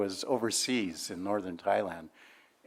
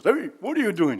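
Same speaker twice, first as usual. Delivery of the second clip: scolding dad; 10 semitones higher, 5 dB louder than usual. Retold or imitated. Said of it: "my dad comes in the kitchen and goes".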